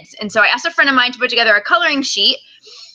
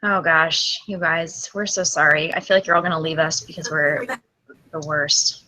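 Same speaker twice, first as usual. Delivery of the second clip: low, soft, sheepish apology; quiet, dejected admission; deflated apology; 6 semitones lower, 6 dB quieter than usual. Real, not acted. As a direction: apologize sheepishly under your breath. Apologizes to audience for glitches.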